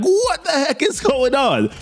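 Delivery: mock falsetto disbelief; bewildered freak-out. Imagining Peter seeing Green Goblin, high-pitched mock shock. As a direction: squeal it high, like a comically baffled guy. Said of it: "homeboy is gonna be like".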